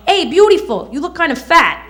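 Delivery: lightly voiced Brooklyn guy; Brooklyn accent. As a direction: Brooklyn come-on with a casual insult. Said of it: "Accent disclaimer".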